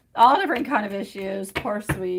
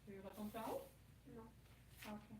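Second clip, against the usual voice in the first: much softer French, away from the microphone; distant, softer aside in French; off mic; 7 dB quieter, faster than usual. Real, not acted. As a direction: turn away and talk to your daughter off mic. Turns away to talk to daughter.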